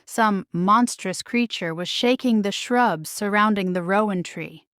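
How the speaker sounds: ordinary delivery, nothing marked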